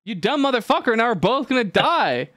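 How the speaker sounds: high-pitched mock outrage at the betrayal; hammed-up furious frog voice; outraged frog. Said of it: "the frog's like".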